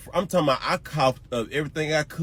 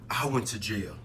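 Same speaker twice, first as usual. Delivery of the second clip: whispered aside; 5 semitones lower, 6 dB quieter than usual. Real, not acted. Aside about jail.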